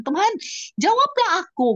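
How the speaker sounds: very high, loud crying plea; desperate plea. Retold or imitated. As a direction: cry out for an answer. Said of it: "aku bilang". (seruan memohon jawaban sambil menangis)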